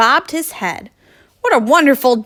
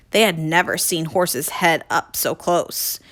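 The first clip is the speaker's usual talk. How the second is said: ordinary delivery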